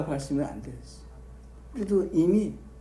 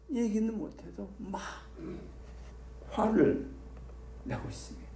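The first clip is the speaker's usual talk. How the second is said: ordinary delivery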